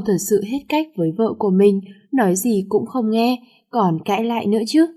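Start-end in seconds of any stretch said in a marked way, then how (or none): none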